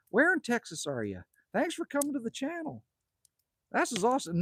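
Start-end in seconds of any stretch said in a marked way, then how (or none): none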